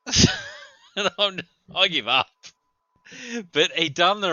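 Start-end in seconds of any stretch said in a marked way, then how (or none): 0.1-2.3 words broken by loud laughter; laughing. Real, not acted. Still laughing at the backwards maneuver, loud laughing words. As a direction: laugh through the words, louder.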